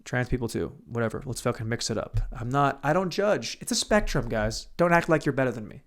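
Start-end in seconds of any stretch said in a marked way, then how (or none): none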